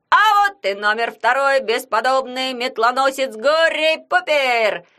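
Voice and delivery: drawn-out fairground barker's call; loud carnival barker. Acted. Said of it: "с интонацией балаганного зазывала завопил Ягун".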